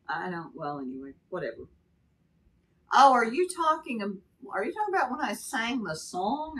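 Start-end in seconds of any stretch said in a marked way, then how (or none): 2.9-6.6 surprised realization. Real, not acted. Surprised realization of viewer comment.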